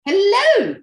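high bright sing-song hello; loud, high, happy hello; cheery hello. Real, not acted. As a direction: greet him with a big lift. Guest greets host cheerfully.

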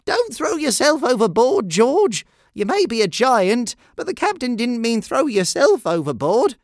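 high, urgent calling voice; first mate's shout. A narrator's reading. First mate stops George going overboard, urgent shouting. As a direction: call out urgent orders to a crewmate. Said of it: "shouted First Mate Steve".

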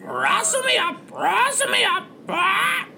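high, repetitive parrot squawk. Acted. Parrot demands food from captain, squawking. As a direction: mimic the phrase back like a screechy bird. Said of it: "Pickles the parrot".

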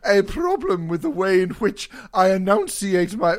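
laughing hammed olde English; mock medieval reenactor, laughing; hammy stage actor. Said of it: "they go".

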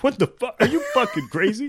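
high-pitched mock outrage, half laughing; imitated outraged colonel. Colonel challenging a scientist, mock outraged and laughing. Acting the film's exasperated colonel.

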